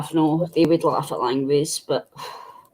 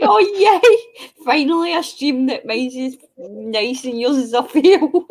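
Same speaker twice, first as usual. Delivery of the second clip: mock gloating; 7 semitones higher, slower than usual. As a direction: crow over winning to wind her up. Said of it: "I was winding Nicky up".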